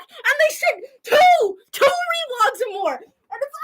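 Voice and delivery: very high, loud, near-crying squeal; overwhelmed with joyful excitement; ecstatic squeal. Real, not acted.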